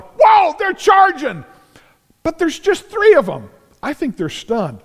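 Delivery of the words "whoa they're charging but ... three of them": much higher, loud startled yell; alarmed shout. Acted. Philistine soldiers seeing three men charge, shouting in alarm. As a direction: cry out a warning as they come at you.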